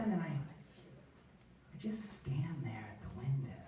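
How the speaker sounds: whispered, slow and soft; hushed whisper. Retold or imitated. Comic recalling staring at the guard, whispered. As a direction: whisper it like a creepy ghost.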